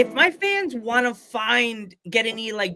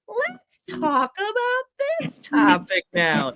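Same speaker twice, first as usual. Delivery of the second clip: put-on high, slow, snooty valley-girl drawl; mock a mean-girl queen bee; high, slow snobby teen girl; 12 semitones higher, slower than usual. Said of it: "I felt like Mean Girls".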